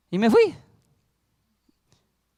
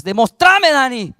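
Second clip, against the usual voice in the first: louder, higher mock shout of Demostrame; retold shout; 4 semitones higher, 10 dB louder, slower than usual. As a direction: throw Demostrame out as a demand. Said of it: "Así me gritaba". (grito imitado: Demostrame más alto y fuerte)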